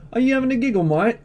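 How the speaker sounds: high, loud mock British accent; hammed-up Brit squawking in a British-Australian hybrid accent; cheeky, over-the-top British or Aussie accent; put-on British-Australian accent. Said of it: "how's my accent there?".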